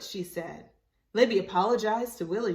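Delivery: plain reading voice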